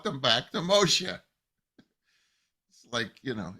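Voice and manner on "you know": ordinary talk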